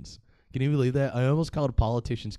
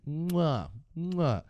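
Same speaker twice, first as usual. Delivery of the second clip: chef's kiss noise; 6 semitones higher than usual. Acted. Comedian relishing savage political trash talk, mock chef's kiss. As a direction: blow two appreciative kiss sounds. Mimes kisses in praise.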